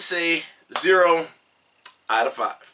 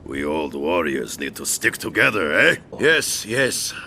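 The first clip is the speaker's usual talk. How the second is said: much lower mocking Russian accent; Bo' Rai Cho Russian accent; 10 semitones lower than usual. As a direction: ham up a deep Russian old warrior. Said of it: "This is Bo' Rai Cho with a Russian accent".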